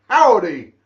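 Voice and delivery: a big, loud mock-cowboy howdy; playful hammed-up cowboy call. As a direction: belt out a friendly cowboy greeting. Answering chat's cowboy greeting.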